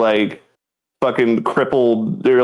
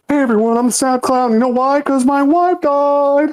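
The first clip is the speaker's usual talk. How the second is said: high, whiny sad clown voice; mournful sad clown whine; 14 semitones higher than usual. Acted.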